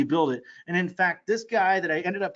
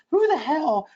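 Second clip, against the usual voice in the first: amazed exclamation; 9 semitones higher, 4 dB louder, slower than usual. Retold or imitated. Mike's amazed reaction retold.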